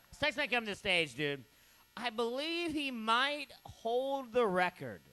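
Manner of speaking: ordinary talk, nothing marked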